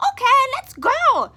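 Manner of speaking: very high, loud chirpy bird; happy bird. Acted. The birds agree to go, high and loud. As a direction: chirp a glad yes.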